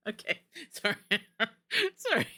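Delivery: real giggles breaking into the words; laughing apology. Real, not acted. Streamer apologizing to chat after the automod catch, laughing.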